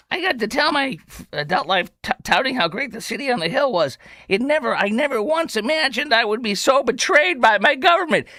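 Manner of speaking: high, whiny parody of a complaint; exaggerated wounded outrage; mock lament. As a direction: read it with hammed-up hurt. Rereads quote as mock lament.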